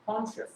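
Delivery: "কনশাস" in two syllables, kon-shas; correct pronunciation. Shown as a demonstration. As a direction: say "কনশাস" with a sh sound, no i. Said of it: "উচ্চারণটা কি হবে?".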